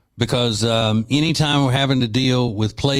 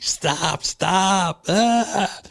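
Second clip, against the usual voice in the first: mock pleading; 8 semitones higher, much slower than usual. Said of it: "He's like".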